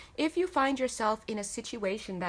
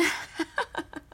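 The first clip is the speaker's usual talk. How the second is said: airy high-pitched giggle; high breathy giggle; 9 semitones higher than usual. Real, not acted. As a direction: burst into a quick airy giggle. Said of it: "sorry, I'm laughing because".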